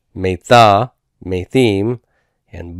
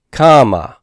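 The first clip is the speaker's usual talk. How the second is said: "comma" with first syllable high and strong; stress on first syllable; 4 semitones higher, 4 dB louder than usual. Shown as a demonstration. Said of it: "Notice the accent is on root one".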